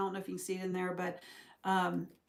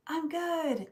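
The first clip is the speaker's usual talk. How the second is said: high, breezy mock reassurance; mock carefree; 9 semitones higher than usual. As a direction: play at being totally fine. Acts out a momentary upbeat choice.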